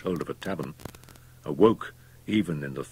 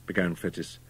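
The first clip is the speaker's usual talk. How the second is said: ordinary delivery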